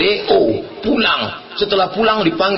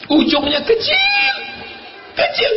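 mock squeal. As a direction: squeeze the words out high and long. Comic squeal about tight trouser ends. (memekik kocak, melengking panjang)

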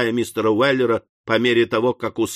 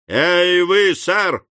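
higher, louder shouted call; 6 semitones higher, 4 dB louder, much slower than usual. A narrator's reading. Sam hailing Job a third time, shouting. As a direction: yell so he can't pretend not to hear. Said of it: "крикнул Сэм в третий раз".

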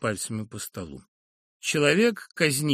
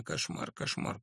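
whispered under the breath; a soft horrified whisper to himself; inner thought; 11 dB quieter than usual. Acted. Professor thinks in horror, whispering. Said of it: "подумалось ему".

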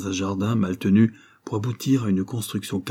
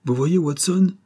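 amused, higher-pitched aside; Sherlock Holmes; 7 semitones higher than usual. A narrator's reading. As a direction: say it lightly, as if amused. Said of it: "me dit Holmes en riant".

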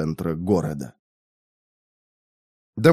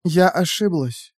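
sad; 6 semitones higher, slower than usual. A narrator's reading. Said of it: "печально согласилась девушка".

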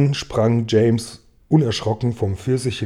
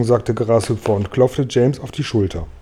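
plain reading voice

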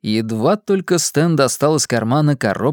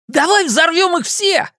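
high, gleeful yell; overjoyed shout. Acted. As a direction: shout it with wild joy. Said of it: "закричал Ричи вне себя от радости".

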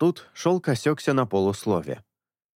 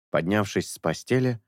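usual speaking voice

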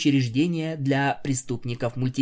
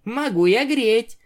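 talking pony; 10 semitones higher, 5 dB louder than usual. Acted. Pinkie threatening playfully.

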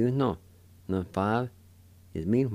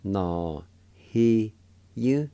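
syllable-by-syllable demo; 2 semitones higher, 5 dB louder, much slower than usual. Shown as a demonstration. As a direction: separate syllables climbing in pitch. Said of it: "First part is pronounced like this".

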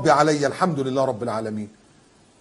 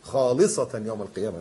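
ordinary talk

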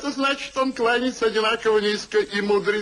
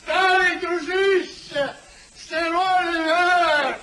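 higher, slow, drawn-out hail; joyful greeting; 7 semitones higher, slower than usual. Acted. Returning friend greets Sir Peter, slow and delighted. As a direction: call out to an old friend with delight. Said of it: "Я же просил тихо".

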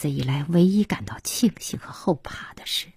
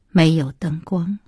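ordinary delivery, nothing marked

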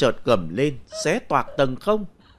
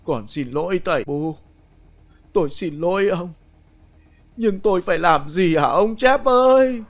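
sobbing apology; 4 semitones higher than usual. Acted. Grieving widow begs her dead husband for forgiveness, sobbing. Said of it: "gục đầu nức nở nói".

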